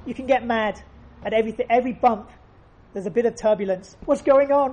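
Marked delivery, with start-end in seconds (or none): 4.1-4.7 higher and louder alarmed "What's going on?"; startled flyer, sharp and loud; mock panic. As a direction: jolt up at the bump in alarm.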